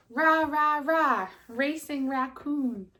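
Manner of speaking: loud high cheer, then slow on the name. Acted. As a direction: cheer it out like a crowd. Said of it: "They shouted".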